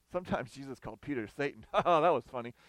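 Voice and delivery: chuckling through the words; laughing. Real, not acted. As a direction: laugh as you tell the joke. Preacher laughs at own joke.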